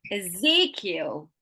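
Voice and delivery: slow "Ezekiel", e-ZEE-kiel; stress on second syllable. Shown as a demonstration. Teacher quizzing a student, slow strong ZEE in "Ezekiel". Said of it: "tell me where I'm emphasizing".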